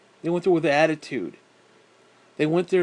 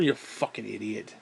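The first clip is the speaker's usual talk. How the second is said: ordinary talk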